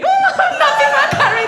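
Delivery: high, loud and fast, laughing through it; hammed-up laughing outcry; laughing protest. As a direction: squeal it in mock horror. Indians voiced in protest.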